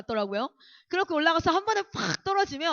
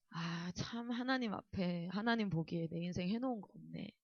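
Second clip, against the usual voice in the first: much softer, lower confession; rueful regret; 6 semitones lower, 13 dB quieter than usual. Retold or imitated. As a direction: quietly admit a wasted life. Realisation before God.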